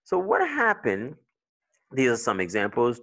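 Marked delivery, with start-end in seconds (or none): none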